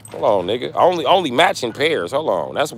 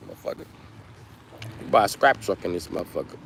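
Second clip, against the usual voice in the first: ordinary delivery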